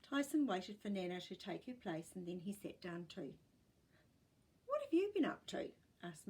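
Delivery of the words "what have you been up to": high, quick, bright motherly question; mum asking. A narrator's reading. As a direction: ask cheerfully like Mum. Mum asks Tyson.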